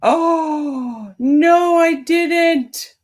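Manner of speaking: high, loud cry of dismay; dismayed outburst. Real, not acted. Streamer realising she just shut everything down, dismayed outburst.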